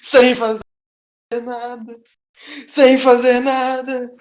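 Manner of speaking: high, loud gleeful yelling; triumphant shout. Real, not acted. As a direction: crow your win at full volume.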